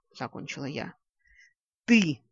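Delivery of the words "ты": loud, angry accusation; a short burst of fury; roar. A narrator's reading. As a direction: roar it at the culprit. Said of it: "взревел оборотень".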